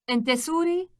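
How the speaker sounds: ordinary talk, nothing marked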